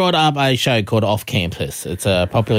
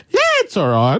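put-on, pitched-up reply; squeaky mock brush-off; mimicked high voice; 5 semitones higher than usual. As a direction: send up her voice going high with embarrassment. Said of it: "And her voice goes".

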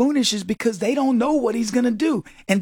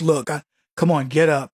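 Trump; 8 semitones lower than usual. Retold or imitated. Acts Trump over a downed opponent.

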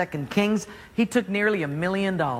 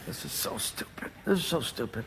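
grumbling; 4 semitones lower, 11 dB quieter than usual. Acted. Naaman muttering while dipping.

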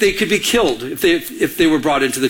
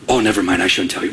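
much lower, mock-reluctant backing off; coy reluctant gossip; 10 semitones lower, faster than usual. Gossip teasing a listener with news, coy and low. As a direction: act a gossip holding back, voice dropped.

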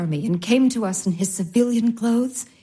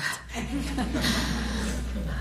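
soft laugh; 9 dB quieter than usual. Acted. Olga laughing softly.

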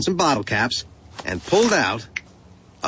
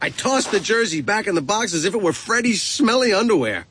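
ordinary delivery, nothing marked

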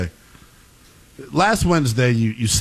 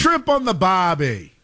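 slow, higher mock Australian accent; 8 semitones higher, slower than usual. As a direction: drawl it like a tourist-ad Aussie. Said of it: "That's an Australian accent".

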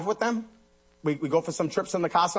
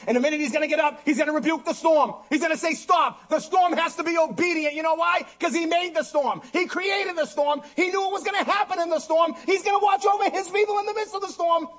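high, rising proclamation; impassioned preaching; 11 semitones higher than usual. Real, not acted. Preacher proclaiming Jesus will rebuke the storm, impassioned preaching. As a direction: build higher and higher with urgent conviction.

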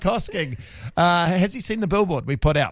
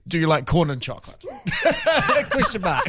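laughing; 11 semitones higher than usual. Real, not acted. Rereading the embarrassing text.

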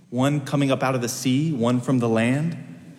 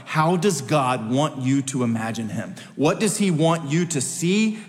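ordinary delivery

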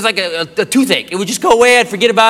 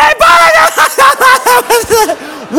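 very high, loud garbled shriek of laughter; breathless hysterical laughter; 6 dB louder than usual. Retold or imitated. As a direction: try to talk through a mock laughing fit. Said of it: "she can't breathe".

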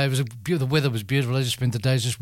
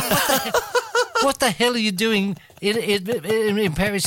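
laughing; 8 semitones higher than usual. Retold or imitated. Retold question to the waiter.

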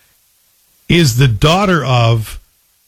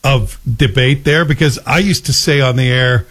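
ordinary talk, nothing marked

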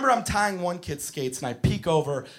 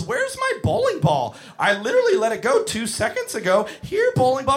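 put-on dopey kid; 6 semitones higher, 3 dB louder than usual. Clueless kid voiced.

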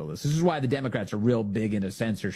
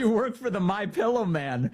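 mock Pelosi line, pitched higher; higher mocking quote; imitating Pelosi; 10 semitones higher than usual. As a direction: sneer it like Pelosi. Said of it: "she says".

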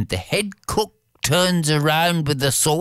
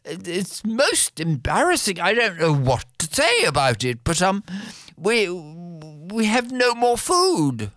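grovel to the king in a higher voice, hesitating; apologetic cook; 7 semitones higher than usual. Acted. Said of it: "walks in and says".

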